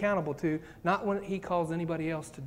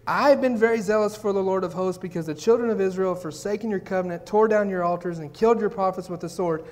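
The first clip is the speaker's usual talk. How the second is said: louder, protesting to God; indignant complaint; 6 dB louder than usual. Acted. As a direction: plead your case with heat. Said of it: "Notice the answer. It hasn't changed".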